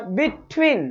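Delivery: "between" with a slight gap, then a stressed "tween"; stressed second syllable. Shown as a demonstration. Teacher repeating the stress demo, leaning on the end of "between". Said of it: "ठ के ऊपर जाकर मैं थोड़ा सा stress".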